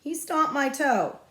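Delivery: put-on high, slow tattling kid voice; whiny child. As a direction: complain to the teacher like a hurt kid. Child complaining to teacher.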